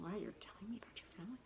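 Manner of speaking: breathless whispered disbelief; whispered surprise. Retold or imitated. To herself, surprised the doctor shares family: fast whisper. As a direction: hush it out fast, astonished.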